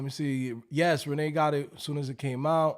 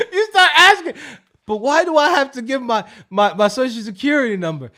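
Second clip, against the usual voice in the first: laughing; 6 semitones higher, 8 dB louder than usual. Retold or imitated. Laughing while voicing a caller.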